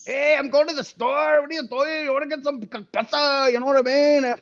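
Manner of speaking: put-on high, sing-song Mexican accent. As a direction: chat like a friendly Mexican neighbor. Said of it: "there's Mexicans where it's like".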